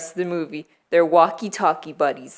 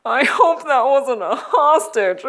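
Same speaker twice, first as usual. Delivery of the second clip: laughing quote; 7 semitones higher, 4 dB louder than usual. Retold or imitated. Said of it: "one of the cops are like".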